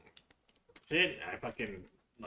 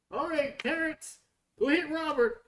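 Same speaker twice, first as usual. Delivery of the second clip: high, louder coach call; 5 dB louder than usual. Retold or imitated. Coach sending Terrence to tackle, high mock call. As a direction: coach calling it out, much higher. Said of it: "then he'd be like".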